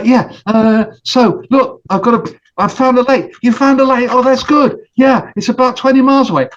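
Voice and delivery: squeaky friends sharing big news; excited animal voices. Acted.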